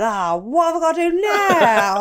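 high, slow whiny complaint; exasperated whine. Retold or imitated. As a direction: moan it like a hopeless baker. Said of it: "audio of me going".

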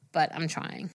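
ordinary talk

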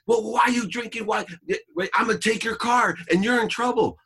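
very high, loud rant; angry scolding. Retold or imitated. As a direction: imitate an irate father laying down punishment. Acted quarrel, father threatens.

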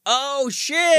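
high, loud burst of sudden realization; mock amazement. Music fan discovering the original of a sampled song, mock amazed. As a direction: exclaim it as a hammed-up revelation. Acted reaction on finding the original.

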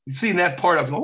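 ordinary delivery

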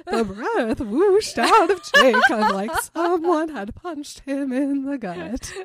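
high, loud reading dissolving into laughter; helpless high-pitched giggly narration; laughing while reading. A narrator's reading. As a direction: try to read through a laughing fit. Reads passage, both howling with laughter.

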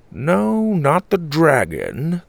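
slow, drawn-out grandpa, pitched high; slow, ruminating denial. A narrator's reading. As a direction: draw out each word as a pondering grandpa. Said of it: "Grandpa said slowly".

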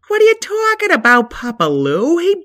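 put-on high pitched excited kid voice; high squeaky voice. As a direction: squeak it out, fast and loud. Second character voiced high.

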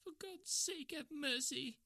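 shrieked. A narrator's reading. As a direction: shriek out a plea for mercy. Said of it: "he shrieked".

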